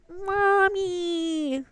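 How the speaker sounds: put-on high, drawn-out child-like call for mommy; Levin impression. Levin runs up to mommy, high drawn-out call. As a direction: stretch it out high, calling for mommy. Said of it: "That's my Levin impression".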